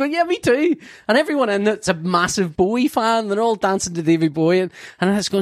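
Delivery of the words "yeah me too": high, shouted glee; delighted agreement; mock thrilled yell; excited. Replying excitedly at concert.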